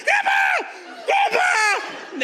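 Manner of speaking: high, panicked scream for Grandpa; shrill-pitched desperate yell; screaming for help. Retold or imitated. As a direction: scream it like a terrified kid. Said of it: "I am screaming for my grandfather".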